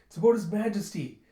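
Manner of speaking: high, loud and hurried; urgent report. Retold or imitated. Chul reporting urgently to the sleepy general. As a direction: blurt it out breathless.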